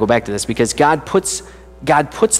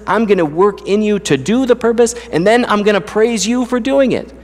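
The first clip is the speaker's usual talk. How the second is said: preaching with mounting fervour, pitched higher; rising preacher voice; 5 semitones higher than usual. Acted. Preacher telling his congregation what God gives them, fervent and building. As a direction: lift the congregation with each line, building.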